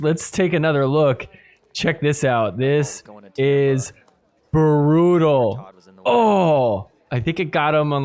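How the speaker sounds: ordinary delivery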